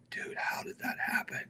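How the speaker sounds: whisper. Retold or imitated. Quiet aside to Corey.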